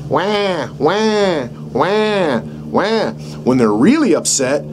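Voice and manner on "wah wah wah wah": imitating a crying baby, higher; mock baby cry. Shown as a demonstration. Instructor shows how a baby cries. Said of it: "They cry".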